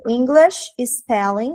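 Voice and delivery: very slow, stretched English words; slow dictation. Shown as a demonstration. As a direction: dictate it slowly for students. Dictating the phrase while writing.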